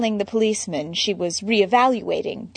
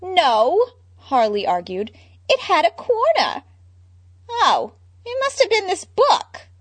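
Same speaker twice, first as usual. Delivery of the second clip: high squeaky Harley with a laugh; 10 semitones higher, 4 dB louder than usual. Acted. Harley, handcuffed, complaining with a giggle. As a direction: play it dazed and giggly.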